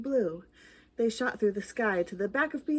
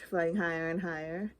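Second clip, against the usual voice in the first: ordinary delivery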